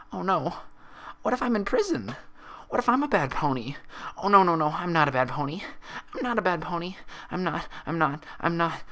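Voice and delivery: high, breathless panic spiral; hyperventilating denial; panicking. Acted. Pinkie panicking that she is a bad pony, high and frantic. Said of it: "began to hyperventilate".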